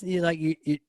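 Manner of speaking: usual speaking voice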